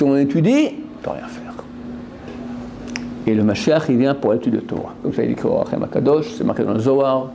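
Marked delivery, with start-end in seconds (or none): 1.0-1.4 whispered, voiceless. Real, not acted. Preacher confiding to listeners in a hushed whisper. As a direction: drop to a secret whisper.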